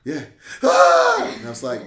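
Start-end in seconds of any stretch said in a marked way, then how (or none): 0.6-1.3 loud, high imitated crying wail; child bursting into tears over his belt; mock kid wail. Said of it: "he bust out crying again".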